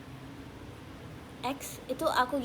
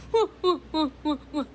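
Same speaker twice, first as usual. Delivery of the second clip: punchier, louder huffing hua-hua; louder hua-hua huffs; 2 semitones higher, 4 dB louder than usual. Shown as a demonstration. Trying the hua-hua tone again for viewers, huffed louder. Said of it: "Gitu ya".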